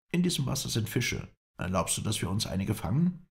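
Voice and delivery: high-pitched character voice; high voice for the Kurd; Kurdish spokesman. A narrator's reading. Kurdish spokesman asks to fish.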